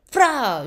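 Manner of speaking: loud, high burst imitating a jab; sudden explosive mock sound effect; imitated jab noise. Imitates the anesthetist's second painful jab.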